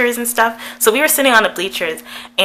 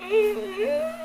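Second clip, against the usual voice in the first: high, soft stifled giggle; demonstrated giggle; 9 dB quieter than usual. Shown as a demonstration. Girl showing how she reacted to the kiss offer, stifled giggling. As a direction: squeeze out a quiet, high-pitched giggle. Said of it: "I was just like".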